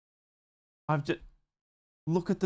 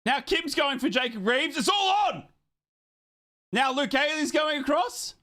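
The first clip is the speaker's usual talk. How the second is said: excited shouting; 11 semitones higher than usual. Real, not acted. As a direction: shout the fight as it unfolds. Play-by-play of the brawl.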